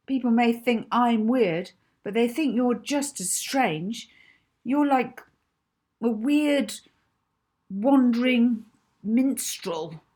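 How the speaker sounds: put-on loud, high, indignant girl; heated accusations. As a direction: keep pushing the insults hotly. Molly keeps attacking Rocky.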